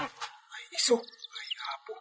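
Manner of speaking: whispered, breathless dismay; a soft unvoiced gasp of worry; hushed dismay. Hushed reaction to bad news.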